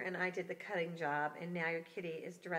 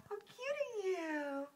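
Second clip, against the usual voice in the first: pet talk; 10 semitones higher, slower than usual. Real, not acted. Sitter cooing at the cute cat.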